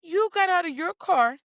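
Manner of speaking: rising outraged are-you-insane rant; incredulous scolding. Retold or imitated. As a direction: scold them like they've lost their mind. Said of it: "and it's like".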